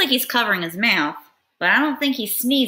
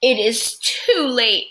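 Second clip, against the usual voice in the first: dramatic; 3 semitones higher, 3 dB louder, slower than usual. Acted. Dramatic story moment.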